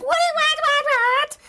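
very high squawk of disbelief; hammed-up squeal, far above your own voice; mock shock. Patient hearing the diagnosis, hammed-up shock. Acts her shocked reply.

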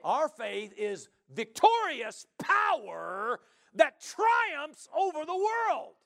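slow, high, shouted proclamation; full-force preacher shout; triumphant preaching. Real, not acted. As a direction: declare it triumphantly, stretching each big word.

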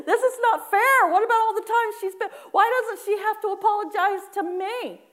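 high, loud mock tantrum; hammed-up outraged complaint, close to laughter; indignant whining. Worshipper arguing with God over apologizing, in a loud mock tantrum. Acted protest, laughing between lines.

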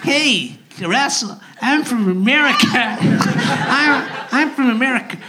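exaggerated stage American drawing out the vowels; American accent. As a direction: put on a broad, cheesy American voice. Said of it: "I can do any accent".

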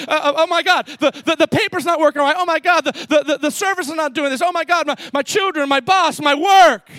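loud, hurried, panicky outburst; frantic panic. Acted. Disciples panicking to sleeping Jesus in the storm, frantic loud cries. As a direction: babble in a frightened rush.

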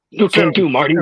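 put-on high eager old scientist; wide-eyed mad inventor; Doc Brown voice. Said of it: "trying to channel the spirit of Christopher Lloyd".

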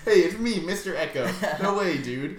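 loud, high, hammed-up character intro; Mister Echo. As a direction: announce yourself like a cartoon guest. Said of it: "It's like".

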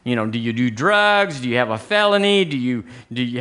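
ordinary talk, nothing marked

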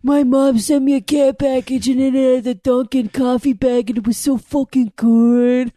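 put-on loud East Coast accent. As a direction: homesick East Coast local. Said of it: "let her go".

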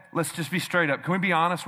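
ordinary talk

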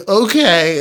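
small disappointed agreement, imitating a child; a hint of a tearful daughter; sad little girl. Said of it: "continued to cry".

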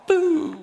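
a high, hammed-up boo-hoo of a sob; mock crying wail. Pastor retelling how he wept at the mirror, in a mock high sob. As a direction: imitate bawling crocodile tears, high and drawn out. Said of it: "gråter krokodiltårar".

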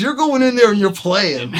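loud, high-pitched retold scolding; exasperated insistence. Friend to balking musician at the club door, loudly exasperated. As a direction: imitate a friend laying down the law.

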